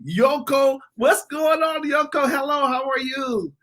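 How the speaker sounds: high, slow, delighted hello; gushing greeting. Real, not acted. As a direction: greet a friend in a high excited voice. Effusive greeting of friend in chat.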